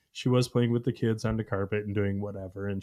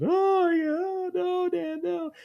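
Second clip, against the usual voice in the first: put-on much higher, whimpering child pleading; mock toddler crying; much slower than usual. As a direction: imitate a little girl begging her dad. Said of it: "and she's crying".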